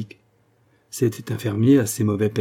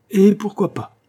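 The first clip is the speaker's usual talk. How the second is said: much higher and louder, cutting in; eager interruption; 10 semitones higher, 6 dB louder than usual. A narrator's reading. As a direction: jump in with gusto. (interrompre vivement, beaucoup plus haut et plus fort)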